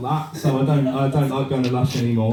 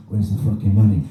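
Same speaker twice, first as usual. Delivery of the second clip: low interrogator's growl, played for laughs; mock mobster; 8 semitones lower than usual. Mobster interrogating a bath toy in Toy Town, low mock menace. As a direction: lean on them in a low pretend gangster voice. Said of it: "part of the mob in Toy Town".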